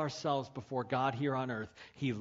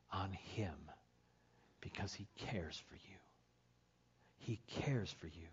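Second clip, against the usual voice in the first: whisper; 5 semitones lower, 11 dB quieter than usual. Real, not acted. Softening the key verse.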